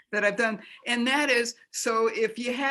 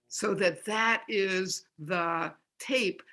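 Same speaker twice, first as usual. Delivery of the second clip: ordinary delivery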